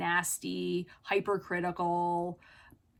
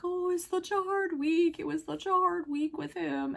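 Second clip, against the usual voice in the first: mock tearful whine, higher than usual; imitated crying; 7 semitones higher than usual. Dog owner crying to the walker about a hard week, imitated tearfully. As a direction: imitate her weepy complaint in a raised, drawn-together voice. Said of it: "she's crying. She's like".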